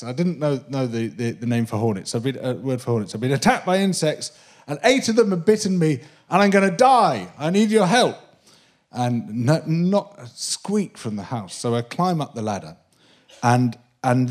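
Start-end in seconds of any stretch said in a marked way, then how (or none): 3.1-8.2 higher, urgent retold plea; pleading shout. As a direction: beg for help at the foot of the ladder.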